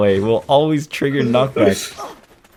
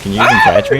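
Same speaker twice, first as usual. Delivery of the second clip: a teasing taunt, pitched way up and louder; mocking taunt; 6 dB louder, much faster than usual. Taunting the friend who couldn't catch him in the retold fight, high mocking jeer. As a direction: jeer at him like he can't keep up.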